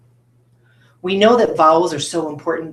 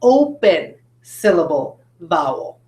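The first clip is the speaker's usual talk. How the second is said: slow, separated words for pupils to echo; say-after-me model; slower than usual. Shown as a demonstration. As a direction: model each word slowly for the class. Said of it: "Can you say that?".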